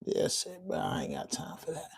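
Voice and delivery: breathy whisper under the breath; whispered aside. Real, not acted. Streamer whispering a dismissive aside about the troll. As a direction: drop to a whisper, brushing it off.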